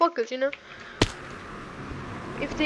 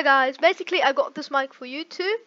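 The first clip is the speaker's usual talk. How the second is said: ordinary delivery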